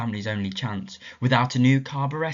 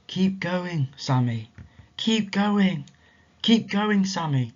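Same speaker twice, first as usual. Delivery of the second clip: higher, coaxing voice; gentle encouragement; 7 semitones higher, slower than usual. Acted. Mysterious voice urging tired Sammy, gently encouraging. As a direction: urge him on kindly.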